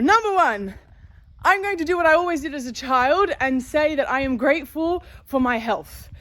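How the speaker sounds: ordinary talk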